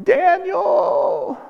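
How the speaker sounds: put-on high, soft crying call of a child to dad; child crying a name. Preacher mimicking his child crying his first name. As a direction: wail the name like a small upset kid. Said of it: "He's saying, like".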